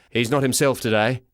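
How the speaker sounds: a hint of a tired father; lightly voiced Dad. A narrator's reading. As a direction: explain gently to police. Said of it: "says Dad".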